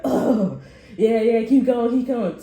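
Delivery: loud, excited urging of reps; mock gym cheer, loud. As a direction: hype him up through the set, hammed-up. Guy encouraging push-ups loudly.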